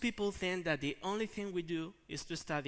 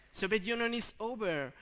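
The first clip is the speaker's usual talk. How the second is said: normal talking voice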